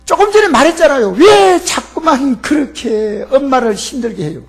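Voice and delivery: a grandson fed up, shouting at his grandfather; yelling grandson. Retold or imitated. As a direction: snap at grandpa in exasperation. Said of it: "소리를 버럭 질렀습니다".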